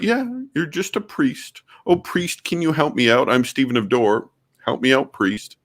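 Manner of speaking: high-pitched sarcastic begging, poking fun at the argument; mocking whiny plea. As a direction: squeak it out like a needy caricature. Mock Stephen of Dort begging priest.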